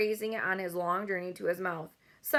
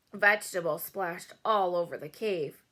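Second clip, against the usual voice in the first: usual speaking voice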